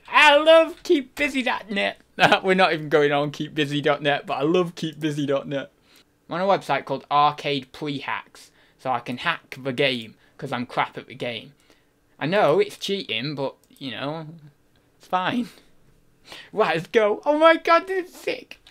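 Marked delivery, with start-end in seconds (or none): 0.1-2.0 high, loud burst of enthusiasm; hammed-up high-pitched delight; mock gushing. Gushing about a website. 17.2-18.6 very high, squeaky excitement; hammed-up high-pitched gush; over-excited squeal. Squealing at the game starting.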